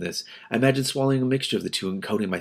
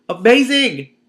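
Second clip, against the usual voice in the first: loud, high Amazing! squealed; excited shout; 13 semitones higher, 10 dB louder, slower than usual. Real, not acted. Reader repeats louder.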